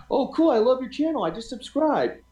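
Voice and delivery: high, bright mock enthusiasm; excited fan. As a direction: gush like a thrilled new subscriber. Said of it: "sometimes people are like".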